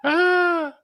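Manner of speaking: high, loud drawn-out "ah"; mock yell. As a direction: joke scream for a TikTok. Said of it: "TikToks of me yelling".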